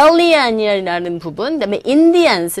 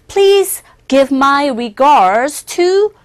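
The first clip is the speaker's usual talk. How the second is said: slow formal model line; much slower than usual. Shown as a demonstration. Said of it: "조금 격식을 차려서".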